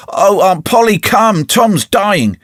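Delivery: alarmed call. A narrator's reading. Sid alarmed calls aunt.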